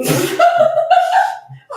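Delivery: high cackling laugh; high cackle. Real, not acted.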